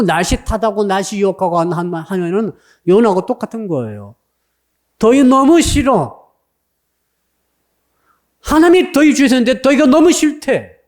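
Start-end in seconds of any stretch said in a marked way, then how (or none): 5.0-10.8 high, loud mock whining about the heat; exasperated complaint. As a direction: act out being fed up, pitch raised. Acts out hating the heat.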